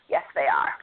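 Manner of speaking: a low whispered yes; hushed mock-solemn agreement; smug whisper. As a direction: whisper it like a proud secret.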